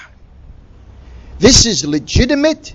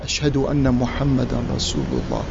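low, much softer, almost murmured; said quietly to oneself; 8 semitones lower, 8 dB quieter, faster than usual. Shown as a demonstration. Speaker demonstrating tarjiyah to his audience, softly to himself. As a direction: keep it inward, a private recitation. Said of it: "I say to myself".

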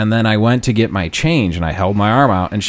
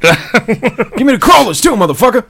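acted donut robber; 12 semitones higher, 3 dB louder than usual. Robber demands donuts from the store, high put-on voice.

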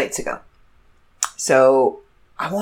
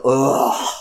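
normal talking voice

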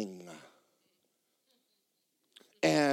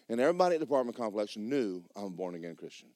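ordinary talk

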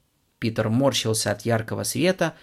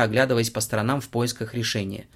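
usual speaking voice

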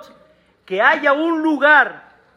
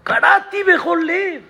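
prayer cry; 7 semitones higher than usual. Shown as a demonstration. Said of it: "Tradúcelo".

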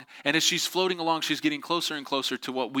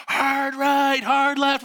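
high, loud rafting guide's yell; frantic called-out steering commands; urgent command shout; 13 semitones higher, 6 dB louder, much slower than usual. Retold or imitated. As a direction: bellow orders over the rapids. Guide calls commands in rapids.

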